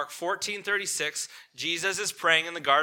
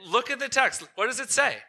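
high-pitched, emphatic appeal to the audience; insistent urging; 8 semitones higher, 4 dB louder than usual. Real, not acted. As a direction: press the point home with raised pitch. Preacher urges audience back to text.

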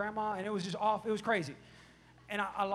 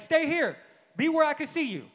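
loud, higher-pitched parental command; stern order; 8 semitones higher, 10 dB louder than usual. Retold or imitated. Dad telling son to stay in sight, stern and loud. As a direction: order the kid to stay put.

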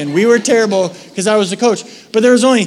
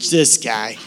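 exasperated sigh; 8 semitones lower, 4 dB quieter, slower than usual. Retold or imitated. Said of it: "And God was still like".

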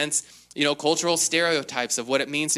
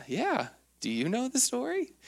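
mock surprised reply; 9 semitones higher, 5 dB quieter than usual. Dad replying to daughter.

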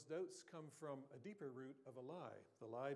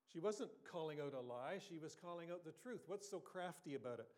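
usual speaking voice

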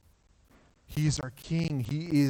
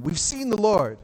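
"We've seen the Lord" bursting out, higher and louder; excited news; 7 semitones higher, 6 dB louder than usual. Acted. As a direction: announce it like joyful breaking news. Disciples excitedly tell Thomas.

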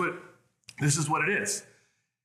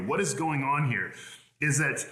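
ordinary talk, nothing marked

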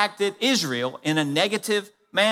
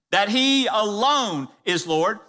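ordinary delivery

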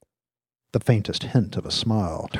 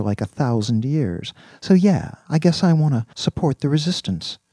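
lightly voiced, chatty young man with a grievance; animated student, a touch indignant; earnest young student; 5 semitones higher, 7 dB louder than usual. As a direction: pour out the story keenly. Voices Virgil recounting arrest and debt.

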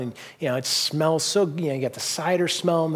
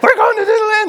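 put-on thrilled child; 8 dB louder than usual. His youngest daughter cheering over the Disneyland trip, high loud delight. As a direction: shout it like a kid who just got the best gift. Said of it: "and she goes".

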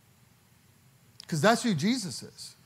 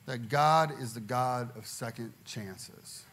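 ordinary talk, nothing marked

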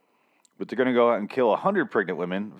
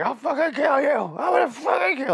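much higher, screamed murderous threat; losing his mind; 4 dB louder than usual. Retold or imitated. Arrested man screaming threats at Allie, enraged shout. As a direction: scream it like he's lost all control. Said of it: "Like, losing his mind".

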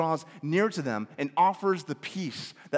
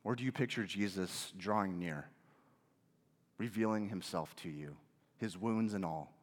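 much softer, lower and tender; gentle; 8 semitones lower, 10 dB quieter than usual. Real, not acted. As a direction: draw them in close and quiet. Contrast: gentle Jesus drawing near.

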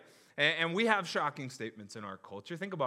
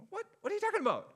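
mock disbelief; much faster than usual. Mocking the crowd's confused reaction.

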